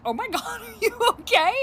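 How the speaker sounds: laughing through a worried question; can't stop laughing while asking. Retold or imitated. Mom laughing at her daughter's sick act, laughing. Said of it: "I was like".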